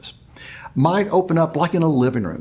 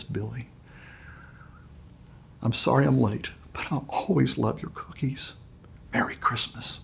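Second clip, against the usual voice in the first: much softer, hushed and kindly; gentle Santa voice; 2 semitones lower, 9 dB quieter than usual. Acted. Santa comforting Billy on the stairs, hushed and tender. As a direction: breathe it like a secret in the dark. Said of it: "the voice from the hand says".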